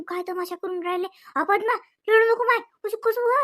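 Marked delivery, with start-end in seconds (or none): 1.4-3.4 raised, higher call; loud, high call to the crying daughter-in-law. Acted. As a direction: call out over her crying. Calling out to crying Padma.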